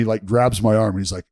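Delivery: usual speaking voice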